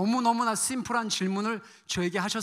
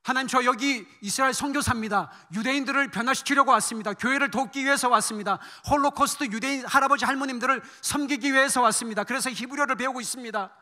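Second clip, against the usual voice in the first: high, loud, urgent self-justification; insistent pleading; 4 semitones higher, 5 dB louder than usual. Retold or imitated. Missionary listing his works to God, urgently insistent. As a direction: reel off your efforts, pitched up and insistent.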